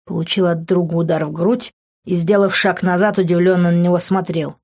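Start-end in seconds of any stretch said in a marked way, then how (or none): none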